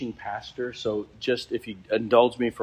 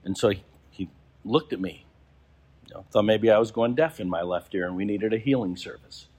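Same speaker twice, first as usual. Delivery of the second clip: ordinary talk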